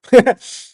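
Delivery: loud, high burst of laughter; short laugh. Real, not acted. Laughing at his own doubting reply to his wife, short loud laugh. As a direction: break into a quick loud laugh.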